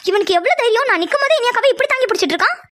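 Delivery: very high, indignant grumble; scandalised, high-pitched annoyance; outraged. Acted. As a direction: fume at someone's nerve. Outraged at man holding elder sister.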